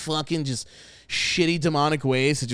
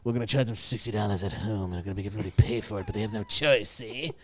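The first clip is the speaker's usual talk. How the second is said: gangster impression; 5 semitones lower than usual. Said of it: "touch the tip of his fingers and just be like".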